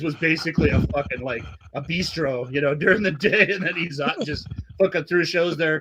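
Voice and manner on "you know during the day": ordinary talk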